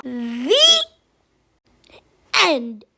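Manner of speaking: very low, drawn-out ending; dramatic the end. Acted. Child ending the story, deep dramatic drop. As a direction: drop way down for the finish.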